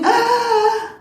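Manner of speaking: very high, loud scream; sudden piercing story scream. Acted. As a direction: let out a bird's scream of pain. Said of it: "opened his mouth to scream".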